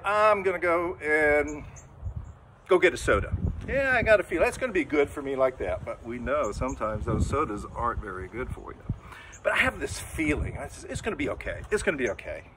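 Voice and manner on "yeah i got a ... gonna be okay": ordinary delivery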